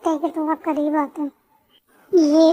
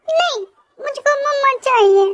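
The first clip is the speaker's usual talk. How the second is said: put-on much higher childlike voice demanding mama; a tearful toddler who wants only his mother; whiny crying child; 10 semitones higher than usual. (बेटा रोते हुए मम्मा माँगता है, ऊँची बच्चे जैसी आवाज़)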